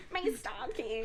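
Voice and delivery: high, whiny plea. Acted. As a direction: whimper it like a helpless housewife. Voicing Dolly.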